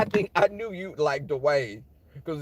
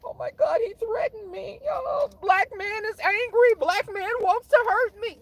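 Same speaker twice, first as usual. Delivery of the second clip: very high, whiny mock-frightened voice; hammed-up tearful victim squeal; mock crying; 4 dB louder than usual. Coworker crying to managers about feeling threatened, mock tearful. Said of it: "they tend to cry".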